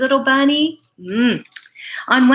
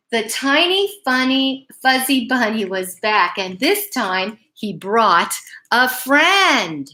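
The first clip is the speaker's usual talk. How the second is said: ordinary delivery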